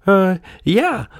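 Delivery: high, hesitant fib; lying puppet. A narrator's reading. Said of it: "lied Pinocchio".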